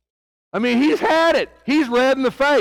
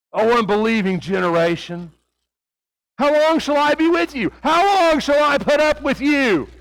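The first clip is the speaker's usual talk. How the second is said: higher, pressing voice, fed up with his disciples; exasperated Jesus; 4 semitones higher than usual. Acted. Jesus fed up with the faithless disciples, exasperated. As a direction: let the frustration break through on how long. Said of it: "can you see it in his voice?".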